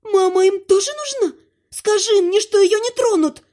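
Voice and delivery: high-pitched tearful begging; distraught pleading. A narrator's reading. Distraught wife pleads for her mother.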